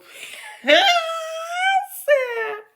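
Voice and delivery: high, shrieking burst of laughter. Real, not acted. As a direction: cackle way up high in disbelief. Reacting to shocking story.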